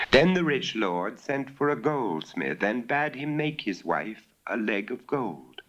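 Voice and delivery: usual speaking voice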